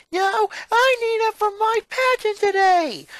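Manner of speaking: high, loud furious shriek; angry yell. Acted. Twin fighting her sister for the brother's luck, furious high shriek. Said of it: "Lolly yelled angrily".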